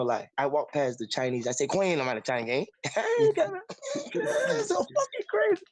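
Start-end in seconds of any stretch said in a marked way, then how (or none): none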